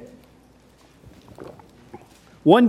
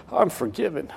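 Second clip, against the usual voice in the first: soft imitation of a moved convert; hushed, lightly acted tearful realization; retold tearful relief; 7 dB quieter than usual. Preacher voices the inmate just saved at jail, softly moved. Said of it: "starts crying".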